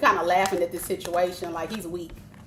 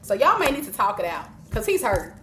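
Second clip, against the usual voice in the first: ordinary delivery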